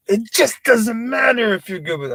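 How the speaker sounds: high, loud fake rant; mock yelling at the crowd; mock outburst. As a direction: blow up in pretend anger. Mock outburst, then "I'm joking.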